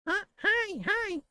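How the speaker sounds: testing a very high puppet voice; Elmo. Shown as a demonstration. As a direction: warm up the falsetto on short greetings. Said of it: "I gotta work it".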